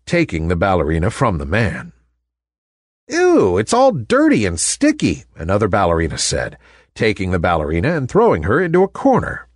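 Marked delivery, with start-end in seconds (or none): 3.1-5.2 disgusted. Acted. Ballerina disgusted by the toy.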